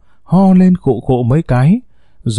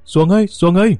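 high calling shout across the water; boy hails a passing canoe, shouting high; shouting to the boat; 5 semitones higher than usual. A narrator's reading. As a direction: call out to a distant boat. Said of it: "chạy ra hét to".

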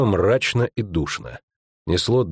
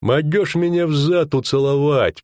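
contemptuous; 6 semitones higher than usual. Acted. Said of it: "презрительно известил Веркта".

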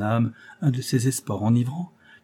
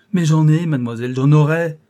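fervent protest; 6 semitones higher, 8 dB louder than usual. Acted.

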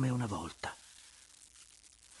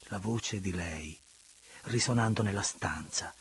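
ordinary delivery

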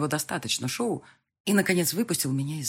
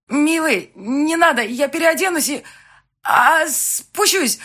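much higher, louder, strained call; 11 semitones higher, 11 dB louder, slower than usual. Acted. Bride shouting to fiancé through the door, strained.

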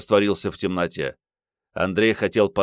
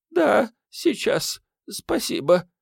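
high, slow, halting woman's voice; dazed woman murmuring; slower than usual. A narrator's reading. Shocked woman accepts the strap, high halting murmur. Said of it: "пробормотала женщина".

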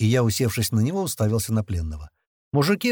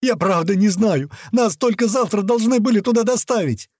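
high, raised pleading voice; panicked yell; 9 semitones higher, 4 dB louder than usual. A narrator's reading. Captive trying to stop the men approaching him, panicked plea. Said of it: "вновь заорал тот".

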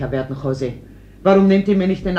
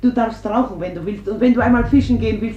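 much higher and rushed, words tumbling out; desperate pleading; 7 semitones higher, faster than usual. Acted. Mother to son, clinging to keep him home: frantic high pleading. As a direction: begs fast, grasping at any promise to keep him.

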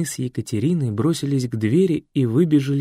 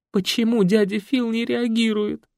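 put-on high, childlike plaintive voice; plaintive drawl; 10 semitones higher than usual. Лена plaintively asks why her uncle won't react. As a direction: draw it out pitifully. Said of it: "жалобно протянула Елена".

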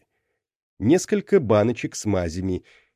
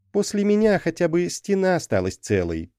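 teasing jab; 9 semitones higher than usual. A narrator's reading. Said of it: "подколол Джарлакс".

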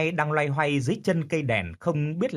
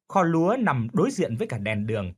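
ordinary delivery

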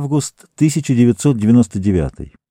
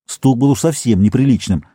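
usual speaking voice

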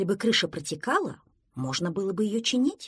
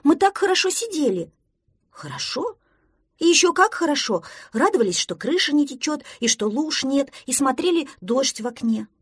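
high-pitched indignant little duckling; 6 semitones higher, 4 dB louder than usual. A narrator's reading. Duckling defends sitting watching the rain, indignant. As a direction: protest in a raised, higher voice. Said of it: "возмутился утёнок".

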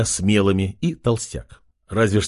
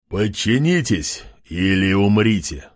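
commanding overlord; 3 semitones lower than usual. A narrator's reading. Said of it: "приказал Артём".

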